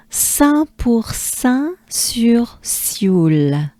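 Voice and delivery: very slow "Saint pour Saint sur Sioule" in French; French place-name demo. Shown as a demonstration. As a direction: separate each French syllable for repeating. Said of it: "Let's do it together".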